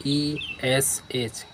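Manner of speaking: letter by letter, spaced out; dictating letters to a class; slow spelling. Shown as a demonstration. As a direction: spell each letter slowly. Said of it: "spelling the letters out".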